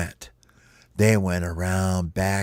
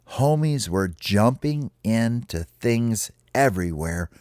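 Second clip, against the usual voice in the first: usual speaking voice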